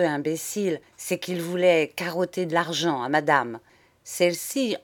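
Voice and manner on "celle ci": usual speaking voice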